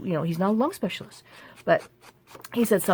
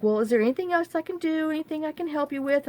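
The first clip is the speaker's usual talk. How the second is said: high chirpy helper; 9 semitones higher, faster than usual. Retold or imitated. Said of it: "the girl's like".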